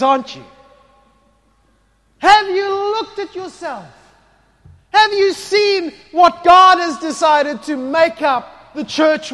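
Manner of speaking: loud, high, driving sermon voice; fervent preaching. Acted. Preacher rousing the congregation, loud and high. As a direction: preach it out to the whole hall.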